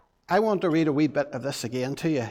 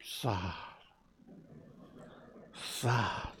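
sad face voice; 7 semitones lower, 15 dB quieter than usual. Shown as a demonstration. Teaching children the sign for sad, low and soft. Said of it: "sad is like this".

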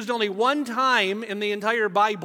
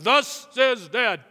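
slow, loud mock-solemn dad voice; dad playing prophet for attention; mock dad proclamation; 2 semitones higher, 4 dB louder, much slower than usual. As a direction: ham up the booming dad decree. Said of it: "I'd be like".

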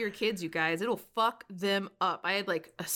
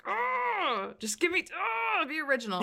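exasperated plea; 11 semitones higher, slower than usual. Retold or imitated. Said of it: "I'm like".

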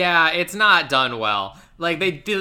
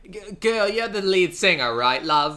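hammed-up Cockney club owner; bad Cockney; 5 semitones higher, 4 dB louder than usual. Club owner lecturing the lead singer, mock Cockney. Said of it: "a Cockney accent".

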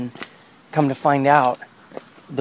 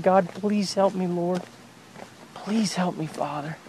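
slow, softer and fading plea; pleading prayer; 5 semitones higher, 5 dB quieter, slower than usual. Retold or imitated. Father retelling his kneeling prayer to God, slow and fading. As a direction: beg quietly, voice sinking away.

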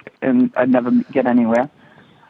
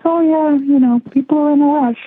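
breezy mom brushing it off, much higher; mother's voice; 12 semitones higher than usual. Retold or imitated. Retelling mother's shrug at parked cars: high breezy voice.

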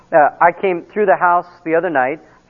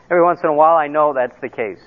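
ordinary talk, nothing marked

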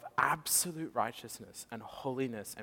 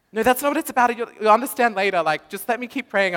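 Jen reassuring; 12 semitones higher, 16 dB louder, faster than usual. Retold or imitated. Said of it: "she's like".

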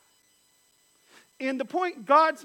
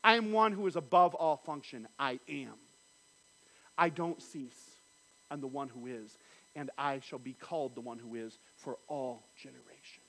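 lower, soft, falling to a hush; hushed voice of God; 9 semitones lower, 5 dB quieter than usual. Acted. Preacher speaking as God, hushed and solemn. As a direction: let each "I am" settle quietly.

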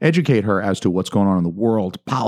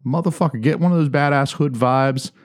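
hyped pitch; 7 semitones higher than usual. Acted.

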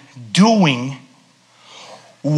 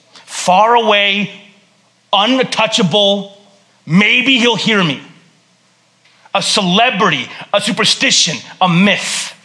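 slow and distant; 2 semitones higher, slower than usual. Pretend. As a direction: stretch each word as if God is far off. Voicing distant view of God.

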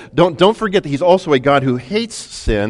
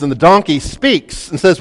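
ordinary delivery

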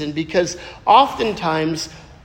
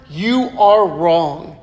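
slow, flat-out blunt, each word weighed; blunt rebuke; 3 semitones lower, much slower than usual. Shown as a demonstration. Preacher showing a rebuke, slow and blunt. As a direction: lay it down like a firm verdict. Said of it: "a rebuke is straight, just forward".

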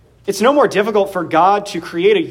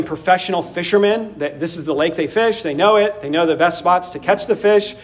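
ordinary talk, nothing marked

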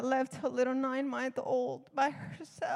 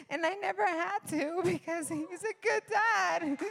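high, tearful and overcome; tearful gratitude; 5 semitones higher than usual. Real, not acted. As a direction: voice rising, breaking into tears. Moved to tears by her testimony.

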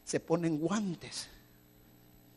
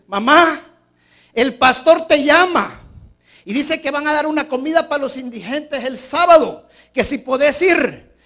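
put-on high, loud boy's call; child calling out; 9 semitones higher, 13 dB louder than usual. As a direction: call out to mom from the doorway. Child calls mother in story.

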